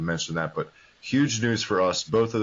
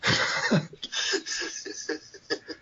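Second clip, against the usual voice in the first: breathy laugh; 13 semitones higher, 4 dB quieter than usual. Real, not acted. Laugh at knock-knock joke.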